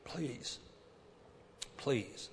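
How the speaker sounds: soft pleading please, slow; quiet begging. Retold or imitated. Preacher voices Paul begging God, soft plea. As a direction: murmur it like a tired plea. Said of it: "begged God".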